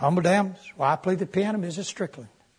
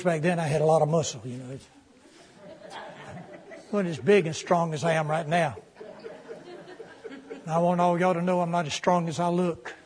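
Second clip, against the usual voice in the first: ordinary talk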